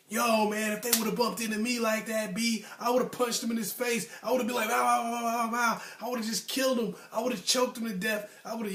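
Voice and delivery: high-pitched mock tough-guy bragging; imitated crazy big talk. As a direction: imitate friends boasting about what they would've done. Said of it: "would always talk super crazy".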